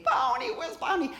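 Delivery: little daughter. Retold or imitated. Daughter demanding Barney on TV, high childish whine. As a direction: imitate a toddler asking for her show. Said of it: "my daughter wanted Barney".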